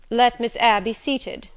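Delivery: loud, slow, stiffly formal order; formal courtesy with impatience underneath; impatient yet formal. A narrator's reading. Master receives the new governess, impatient yet formal. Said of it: "in the impatient yet formal tone".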